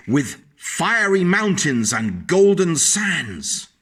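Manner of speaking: awed read-aloud, high and slow; grand storybook narration. A narrator's reading. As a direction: marvel at the far lands. Reading picture book aloud.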